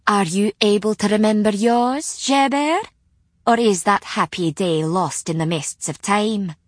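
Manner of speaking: light mocking Scots tease; Scots accent. Scots witch teases Gerbert.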